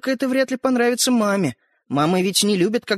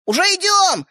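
put-on pitched-up boyish holler; loud reply; 12 semitones higher, 4 dB louder than usual. A narrator's reading. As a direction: call back to the next room. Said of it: "громко отозвался Светлик".

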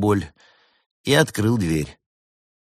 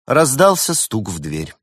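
plain reading voice

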